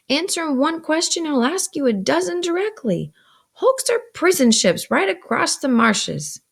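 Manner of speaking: high-pitched, emphatic complaint; exasperated sister. A narrator's reading. Sister points at Pip with needle, exasperated. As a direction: explain with mounting impatience.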